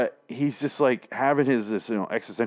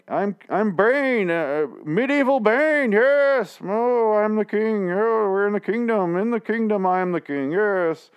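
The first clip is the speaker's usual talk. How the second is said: much higher, slow regal mock voice; imitating the actor's grand king voice; Bane voice; 11 semitones higher, 3 dB louder, slower than usual. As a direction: ham up a medieval king, slowly. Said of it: "He does his Bane thing".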